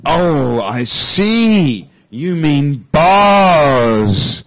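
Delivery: loud, hammed-up dawning understanding, very drawn out; exaggerated realization. Host acts out finally understanding co-host, exaggerated realization. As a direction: stretch BARS out long, as if it finally clicks. Scripted misunderstanding about bears and bars.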